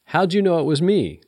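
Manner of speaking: high-pitched, teasing question; playfully coy, pitch held high then falling. A narrator's reading. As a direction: tease the person you just woke. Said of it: "said a playful voice".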